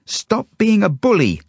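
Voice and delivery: put-on very high, loud child's protest; squealing. A narrator's reading. Zelda held by the kid, squealing. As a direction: squeal it out at full pitch. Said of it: "squeals Zelda".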